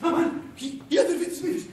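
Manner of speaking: high, startled exclamation; alarmed realisation. Acted. Man learning his intended bride has arrived, alarmed. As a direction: jolt up in sudden panic.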